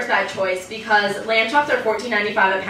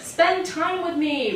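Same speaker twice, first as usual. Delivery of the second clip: higher, slow mom plea; pleading mother; 7 semitones higher, slower than usual. Retold or imitated. Mother asks daughter to spend time, higher slow plea. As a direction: mimic mom asking for company. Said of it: "my mother asks me".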